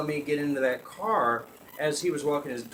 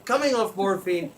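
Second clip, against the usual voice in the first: ordinary talk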